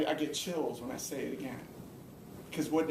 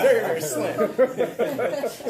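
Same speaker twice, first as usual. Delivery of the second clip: laughing; 6 semitones higher, 9 dB louder, much slower than usual. Real, not acted. Laughs at his own chances.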